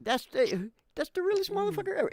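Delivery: high, fast gushing; excited praise. Real, not acted. Praising Steve Irwin to friends, high fast gush. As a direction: burst out in high admiration.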